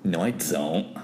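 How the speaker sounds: put-on accented English. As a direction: deny it in Spanish-accented English. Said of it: "Usted tiene acento". (inglés con acento hispano)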